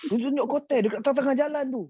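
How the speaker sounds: high, indignant complaint; mock complaining old man. Great-uncle complains to grandmother, high mock outrage. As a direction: exaggerate the grievance like a cross old man. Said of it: "Dia cakap macam tu kan".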